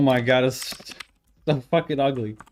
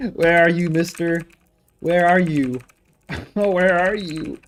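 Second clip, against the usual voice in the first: playful calling; 6 semitones higher, 7 dB louder, slower than usual. Pretend. Calling for missing value playfully.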